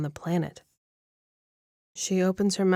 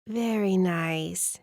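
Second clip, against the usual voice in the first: put-on slow old lady; much slower than usual. Old lady hums approval.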